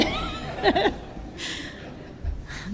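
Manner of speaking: a long high giggle with no voice; amused high laugh at his own drinking story; high breathy laugh. Real, not acted. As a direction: laugh at yourself, high and airy.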